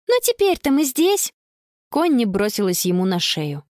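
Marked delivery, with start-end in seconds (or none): 0.1-1.3 put-on high-pitched girl's voice; cheerful girl. A narrator's reading. Girl hugging her grandfather, brightly reassuring. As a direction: lift the pitch to console him.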